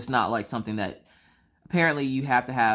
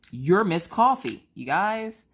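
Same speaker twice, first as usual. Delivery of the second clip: slow, louder, higher mock announcement; recognizing excitement; 7 semitones higher, 5 dB louder, slower than usual. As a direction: draw it out like spotting a star. Clerk recognizes her.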